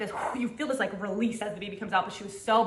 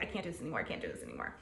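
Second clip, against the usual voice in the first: much softer, lower, drained and giving up; defeated despair; 14 semitones lower, 15 dB quieter, much faster than usual. Retold or imitated. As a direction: sink into a spent, hopeless mumble. Retold despair in labor.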